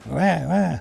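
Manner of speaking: high little newborn wail; baby cry. Acted. Hidden baby crying from the bundle, high wail. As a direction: mimic a tiny baby crying. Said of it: "she heard a baby cry".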